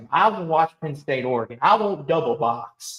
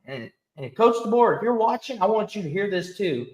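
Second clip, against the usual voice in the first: ordinary talk, nothing marked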